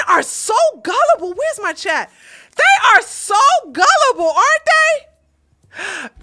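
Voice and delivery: high, loud mocking exclamation; exasperated shout. Real, not acted. Ranting to chat about women.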